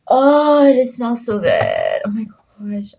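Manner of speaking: oh, it smells so good, slow and delighted; savoring a smell. Real, not acted. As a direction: breathe in the smell, enjoying it. Smelling the book pages.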